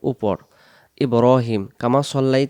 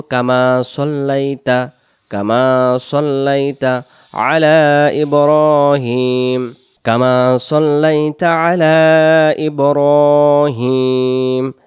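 very slow, louder durood recitation; 5 dB louder, much slower than usual. Shown as a demonstration. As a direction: recite slowly, stretching vowels. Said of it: "আমরা বলবো". (ধীর তিলাওয়াত)

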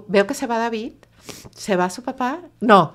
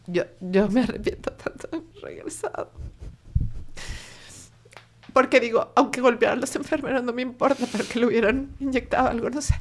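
tearful regret; 4 semitones higher than usual. Real, not acted. Mother regretting that she brought her son back home, tearful. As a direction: voice breaking, higher.

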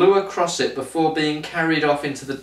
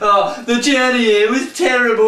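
high, nasal-free mocking whine, much higher; whiny complainer; 8 semitones higher, 4 dB louder than usual. Mocking the character by whining about his trip, high and hammed-up. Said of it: "all he does is piss and moan".

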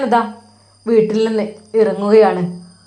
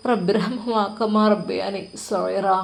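recited prayer; 4 dB quieter, slower than usual. A narrator's reading.

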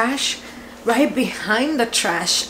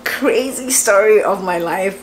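ordinary talk, nothing marked

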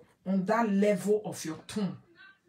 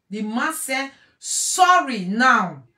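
slow, louder, every word stressed; emphatic insistence; 9 dB louder, slower than usual. Real, not acted. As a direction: drive it in word by word. Final insistence.

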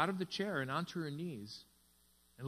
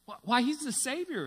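higher, louder exclamation; an excited exclamation about the Savior; exclaiming; 9 semitones higher, 6 dB louder than usual. A narrator's reading. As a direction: blurt it out in surprise. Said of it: "exclaimed my friend's wife".

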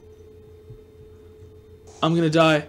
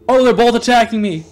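ordinary talk, nothing marked

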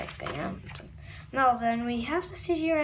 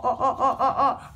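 ordinary delivery

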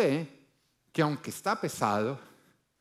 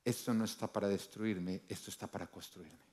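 quiet declaration; 6 semitones lower, 8 dB quieter than usual. Shown as a demonstration. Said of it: "entra en la perspectiva correcta".

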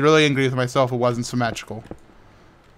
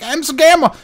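other player; 12 semitones higher, 5 dB louder, slower than usual. Retold or imitated. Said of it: "They were like".

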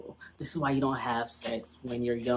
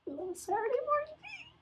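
much higher, giddy squeal; squeaky high voice; 4 dB quieter than usual. Pretend. Squeaky repeat of her own line.

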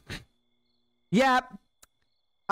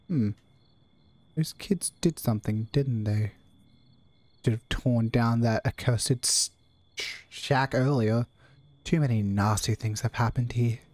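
low gruff janitor; 6 semitones lower than usual. Acted. Janitor keeps talking.

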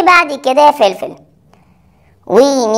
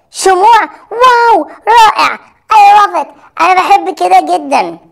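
excited delight; 6 semitones higher than usual. Acted. Learner thrilled at the candle-making suggestion, excited. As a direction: gush over a brilliant idea, pitched up.

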